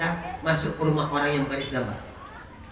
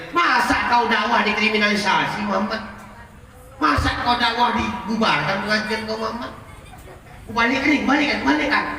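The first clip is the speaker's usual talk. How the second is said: indignant Jibril; 7 semitones higher than usual. Acted. Jibril urging the Prophet, higher and indignant. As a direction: protest on his behalf.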